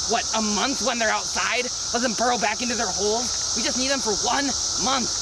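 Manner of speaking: ordinary talk, nothing marked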